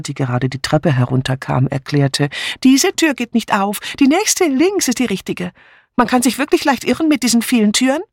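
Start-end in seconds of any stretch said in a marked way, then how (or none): 2.6-8.0 high, fussy woman's voice; light, high-pitched chatterer; chatty Miss Bunner. Acted. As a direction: lift the pitch well up, helpfully explaining.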